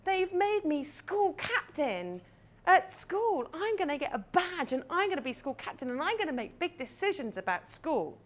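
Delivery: put-on high, eager child's voice bubbling with news; breathless little girl sharing big news; excited little girl. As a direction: burst in like a proud eight-year-old. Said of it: "and she says".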